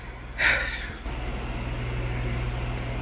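long, breathy, fed-up exhale; long weary sigh. Real, not acted. After redoing the drawers, a long weary sigh. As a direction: let all the air out in frustration. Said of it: "that was irritating".

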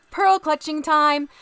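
high, loud and slow, hammed-up pearl clutching; theatrical mock alarm; mock dramatic. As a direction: play it like a scandalised lady. Mock drama about YouTube rules.

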